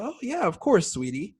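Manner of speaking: high-pitched sweet grandma voice; doting grandma imitation. Grandma readily says yes to grandchild, imitated as high and doting. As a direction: put on a high, indulgent granny voice.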